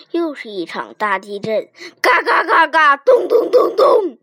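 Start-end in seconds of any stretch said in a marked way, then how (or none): 2.0-4.2 high, rough cracks then pounding thuds; rapid-fire percussive sound effects; imitated cracking and thudding. A narrator's reading. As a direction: hammer out the quake noises. Earthquake noises voiced in story.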